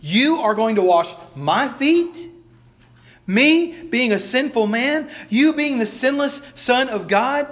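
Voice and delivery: high, slow, astonished protest; indignant disbelief. Acted. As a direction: rise up in stunned disbelief. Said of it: "He says".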